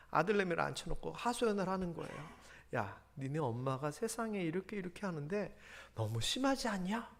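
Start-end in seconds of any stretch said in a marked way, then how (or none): 2.8-7.1 soft, half-whispered grumble to son; hushed complaint. Acted. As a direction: complain under your breath. (아들에게 작게 속삭이듯 불평)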